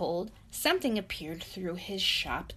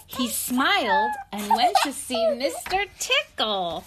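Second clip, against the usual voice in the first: reading through a laugh; 11 semitones higher, 6 dB louder than usual. Real, not acted. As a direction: giggle through the line. Laugh heard while reading.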